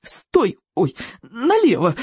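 strained, jolted commands; grating strain. A narrator's reading. Rider on a bolting mount, strained grating cries. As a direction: grate out the words while struggling for control. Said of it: "скрежетал Икинг".

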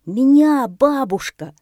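very loud, high boa cry; excited shout. A narrator's reading. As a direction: shout for granny's attention. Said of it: "закричал удав". (громкий крик удава)

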